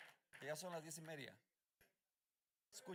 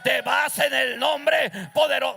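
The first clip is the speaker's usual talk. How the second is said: slow, loud commanding shout; rebuking the devil; 4 semitones higher, 19 dB louder, slower than usual. Real, not acted. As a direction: cast him out with full authority. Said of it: "Cuando el diablo a mí me dice". (reprendiendo al diablo a gritos, lento y firme)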